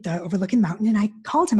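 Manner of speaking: usual speaking voice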